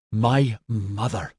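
slow, heavy bitterness; brutal. A narrator's reading. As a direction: lean hard and slowly on each word. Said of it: "Maxon said brutally".

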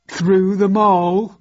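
slow, dopey henchman voice; drawn-out, questioning dimwit; dim-witted henchman. A narrator's reading. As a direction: offer it hopefully to the boss, very slowly. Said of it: "matched only by his stupidity".

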